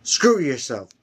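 ordinary delivery, nothing marked

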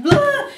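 high, quick comic blurt; cartoonish blah; mock fainting noise. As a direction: imitate keeling over unconscious with a silly noise. Imitates passing out.

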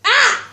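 short, high, loud cry; startled yell. Real, not acted. Player reacting to the game, startled yell. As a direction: let out a sudden high yelp.

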